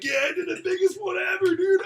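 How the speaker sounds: high, loud victory shout; triumphant boast. Retold or imitated. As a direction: crow it like a champion. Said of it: "I was like".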